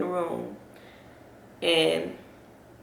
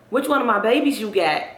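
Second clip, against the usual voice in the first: mama's louder, higher questioning; insistent mama; 5 semitones higher, 6 dB louder than usual. Retold or imitated. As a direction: press the question like a worried mother, voice raised. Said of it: "She just steady asking".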